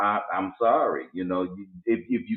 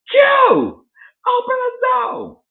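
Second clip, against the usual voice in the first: high-pitched imitation of someone yelling outside; mock yelling; 7 dB louder than usual. Neighbor hollering at his door to be let in, mock yelling. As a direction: call through the door in a piercing high voice.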